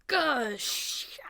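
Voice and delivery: breathless high gasp; panicked gasp. Acted. Queen gasping as the spears turn on her. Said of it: "And she's like".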